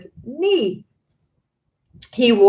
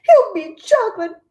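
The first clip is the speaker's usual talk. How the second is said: much higher and louder shriek; horrified mother shrieking at Mister Wonka; 13 semitones higher, 6 dB louder than usual. A narrator's reading. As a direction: screech it out in horror. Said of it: "shrieked Missus Gloop".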